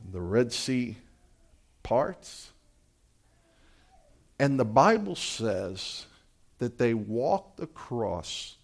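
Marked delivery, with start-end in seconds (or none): none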